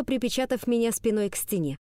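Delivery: ordinary delivery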